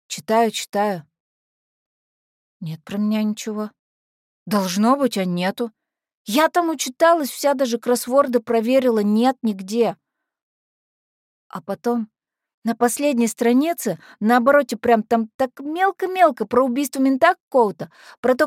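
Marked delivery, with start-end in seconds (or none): none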